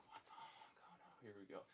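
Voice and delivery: breathy whisper to himself, shocked; under your breath, stunned; whispered panic. Retold or imitated. Sees coworker collapse, muttering.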